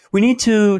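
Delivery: shouted emphatic start; high, loud urgent call. Real, not acted. Urgent rallying call to reverse course.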